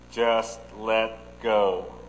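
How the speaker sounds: low and very slow "Just let go"; deep heavenly voice. Retold or imitated. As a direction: let each word fall slowly, as God from above. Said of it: "a deep voice coming from heaven".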